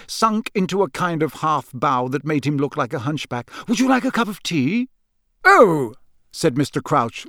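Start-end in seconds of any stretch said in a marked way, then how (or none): 3.7-4.9 high, eager, fawning offer; breathless Percy. A narrator's reading. Young underling offering his boss tea, breathlessly eager. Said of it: "said Percy breathlessly". 5.4-6.0 louder, higher "oh" of surprise; mildly surprised Crouch. A narrator's reading. Boss noticing his underling, mild surprise. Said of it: "in mild surprise".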